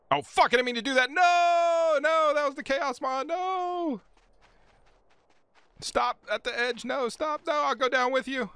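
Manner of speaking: high, fast, loud alarmed pleading; real panic, rushed and high; panicked yelling. Real, not acted. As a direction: scramble to stop a fall. Game chaos, panicking at edge.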